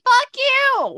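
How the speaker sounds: hammed-up angry retort; mock outburst. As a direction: fire it back in mock outrage. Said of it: "and it's like".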